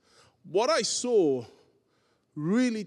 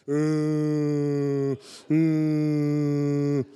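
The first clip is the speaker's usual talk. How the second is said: long, level low hum; mock droning "mm", held on one note; imitated drone; 7 semitones lower, 3 dB louder than usual. Preacher showing how crying gets blocked out, droning hum.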